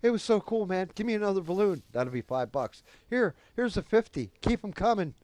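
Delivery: very high, loud, eager voice; giddy high friend. Retold or imitated. High friend demanding another balloon, giddy and loud.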